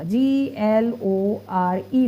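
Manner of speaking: slow letter-by-letter spelling G L O R E. Shown as a demonstration. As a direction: spell the old name letter by letter, slowly. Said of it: "पहले बैंगलोर कहा जाता था". (अक्षर-अक्षर धीरे से spelling बताना)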